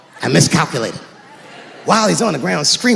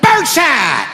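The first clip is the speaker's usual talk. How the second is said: acted shout; 8 semitones higher, slower than usual. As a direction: yell it as you pull the trigger. Acted shooting the intruder.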